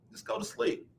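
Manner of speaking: normal talking voice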